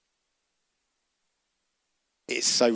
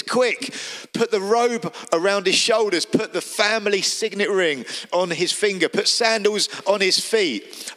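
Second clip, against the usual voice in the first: high, eager commands to the servants; excited orders; 7 semitones higher than usual. Acted. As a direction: hurry them along in delight. Father orders servants joyfully.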